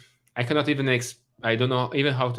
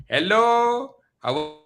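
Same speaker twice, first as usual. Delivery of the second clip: put-on higher-pitched Indian accent, laughing by the end; playful imitation of an Indian accent; 8 semitones higher, 4 dB louder than usual. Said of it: "you have an Indian wife, right? Say".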